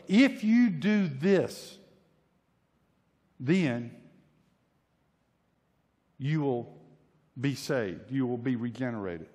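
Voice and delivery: mock preacher laying down the condition, piece by piece; imitated solemn pronouncement. Voicing churches' promise of salvation.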